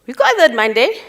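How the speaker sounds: ordinary delivery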